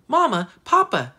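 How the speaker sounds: put-on loud, high child's call; narrated eager shout; bursting in excitedly. A narrator's reading. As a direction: call both parents with sudden excitement. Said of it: "she burst in the door".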